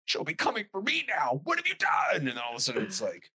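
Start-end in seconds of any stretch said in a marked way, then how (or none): 0.1-2.3 higher and higher terrified wail; overacted horror victim losing it; hammed-up terror. Terrified movie character.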